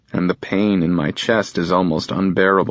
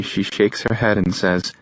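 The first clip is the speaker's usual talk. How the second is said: usual speaking voice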